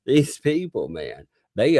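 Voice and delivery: ordinary delivery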